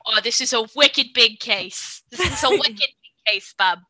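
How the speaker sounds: hammed-up Boston accent, punchy and loud. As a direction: imitate a tough Boston guy calling it a wicked big case. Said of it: "trashiest Boston accent".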